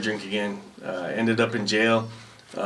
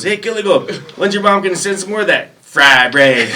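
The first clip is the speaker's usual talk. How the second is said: high, louder eager call; drill sergeant voice; 8 semitones higher, 7 dB louder than usual. Retold or imitated. Drill sergeant asking recruit for more fry bread, high eager call.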